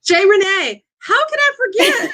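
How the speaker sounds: high laughing shout of recall; excited. Real, not acted. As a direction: burst out, laughing at yourself. Suddenly remembers forgotten name.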